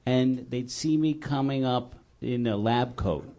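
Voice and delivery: usual speaking voice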